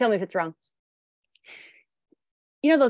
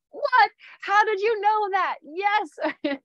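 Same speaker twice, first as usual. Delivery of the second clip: amazed stranger; 8 semitones higher, much slower than usual. Retold or imitated. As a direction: gasp it out as if mind-read. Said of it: "the person is like".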